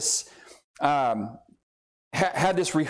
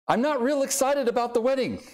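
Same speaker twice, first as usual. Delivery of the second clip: reluctant groom; 7 semitones higher than usual. Retold or imitated. Reluctant fourth brother voiced.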